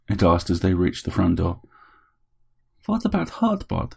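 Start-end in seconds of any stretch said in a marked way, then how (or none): 2.9-3.9 put-on small, high, soft accented plea; pleading little robot. A narrator's reading. Bot asks Harry about Heartbot, softly pleading. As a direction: ask softly and high, worried for a friend.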